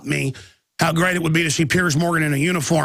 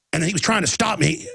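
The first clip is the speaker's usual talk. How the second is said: usual speaking voice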